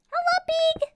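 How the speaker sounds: very high, loud falsetto call; high-pitched greeting. Pretend. Player hailing a pig in game, falsetto greeting. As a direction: squeal hello to an animal.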